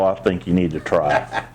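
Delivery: ordinary delivery, nothing marked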